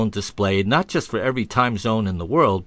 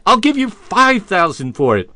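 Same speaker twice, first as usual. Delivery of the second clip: eager raised bid; 7 semitones higher, 6 dB louder than usual. Retold or imitated. Stranger raising his bid.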